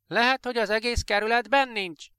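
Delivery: neighbour calling out. Acted. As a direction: shout the guess over the fence, pitched high. Neighbours calling across dark street. (szomszéd magas hangon, hangosabban kiabál át)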